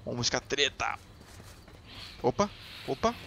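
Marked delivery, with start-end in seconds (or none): none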